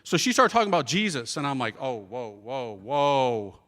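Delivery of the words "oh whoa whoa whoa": low, drawn-out whoa; pitched down, holding them off; wary whoa. Retold or imitated. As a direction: put the brakes on, low and wary. Reacting to talk of Jesus.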